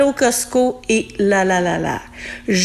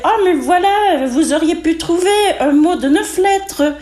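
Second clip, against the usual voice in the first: much higher, a touch smug know-it-all; quiz lady; 7 semitones higher than usual. Retold or imitated. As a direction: mimic the lady who always finds a longer word. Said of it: "Là, elle disait".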